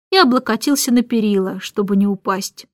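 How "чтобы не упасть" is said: usual speaking voice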